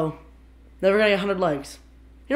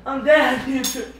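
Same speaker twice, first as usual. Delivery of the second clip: laughing; 4 semitones higher, 3 dB louder, much slower than usual. Real, not acted. Laughing after soy sauce.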